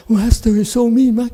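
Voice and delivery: high mocking complaint; a hint of mock whining; mock whine. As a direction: mimic the student's grumble. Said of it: "And then you say".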